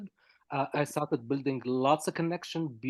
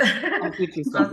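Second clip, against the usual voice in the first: laughing through a slow, louder playful offer; 3 semitones higher, 6 dB louder, slower than usual. Real, not acted. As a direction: chuckle the offer out warmly, teasing. Joking offer after accent talk.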